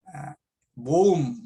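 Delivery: ordinary talk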